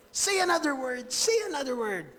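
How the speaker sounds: high-pitched, exasperated demand; angry Victor. Retold or imitated. Creator beating his creature for another word, angry. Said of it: "galit na galit si Victor".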